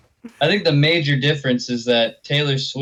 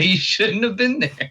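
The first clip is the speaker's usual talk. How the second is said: mock excuse; 9 semitones higher than usual. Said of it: "He's like".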